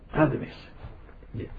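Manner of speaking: هذا ما يصير whispered, no voice; whispered repeat. Shown as a demonstration.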